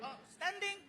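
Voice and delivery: high, small, soft voice; meek answer. Acted. Comic answering the cop outside the theater, meek. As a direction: answer squeakily and quietly.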